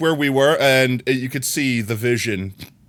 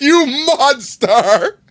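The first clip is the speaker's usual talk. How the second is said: mock outrage; 11 semitones higher, 5 dB louder, much slower than usual.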